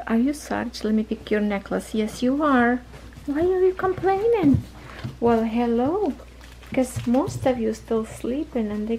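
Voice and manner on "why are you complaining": high-pitched coo to a puppy; high pet talk. Real, not acted. Owner cooing to her complaining puppy Sarge, high pet talk.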